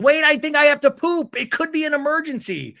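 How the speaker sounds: high, loud comic panic; mock urgent alarm. Recapper acts Tamara needing a toilet, mock urgent alarm. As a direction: blurt it out as a hammed-up emergency.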